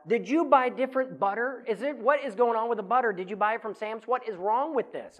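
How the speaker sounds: high, loud alarmed questions at the wife; retold freak-out, voice up and loud; overreacting. Husband grilling his wife over the exploding butter, alarmed and loud. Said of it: "And I kinda overreacted".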